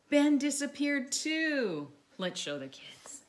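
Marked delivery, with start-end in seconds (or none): 0.1-1.9 slow, higher, astonished reveal; gasping surprise. Acted. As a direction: gasp then reveal it to the children. Gasp before reading twist.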